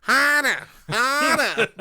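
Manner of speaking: hammed-up moan in a Boston accent; Boston woman climaxing. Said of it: "You ever hear a Boston woman orgasm?".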